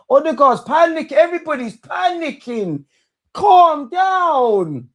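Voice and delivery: rising from high and loud into a crying yell of calm down; building to a shout. Real, not acted. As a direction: build the panic until it bursts out. Fan building into shout at team.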